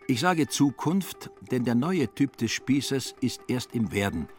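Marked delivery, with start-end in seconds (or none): none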